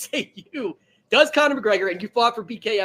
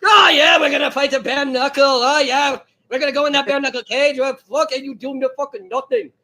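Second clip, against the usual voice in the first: loud, higher-pitched hammed-up fighter impression; 5 semitones higher, 5 dB louder than usual. Co-host mimicking the fighter bragging about bare knuckle, loud impression. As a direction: crow it loudly like a boastful brawler. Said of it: "I'll do my best impression".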